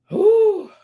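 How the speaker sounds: high, loud overwhelmed whew. Real, not acted. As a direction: blow out a big whew. Overwhelmed exhale.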